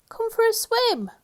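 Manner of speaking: high squeaky call; tiny voice crying out. Acted. Mister Small inviting Mister Tall to swim, high squeak. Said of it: "cried Mister Small".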